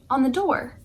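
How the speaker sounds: ordinary delivery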